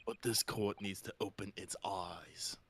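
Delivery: slow dramatic whisper. Acted. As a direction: hush it, theatrical.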